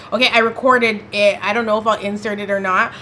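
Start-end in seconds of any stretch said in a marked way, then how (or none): none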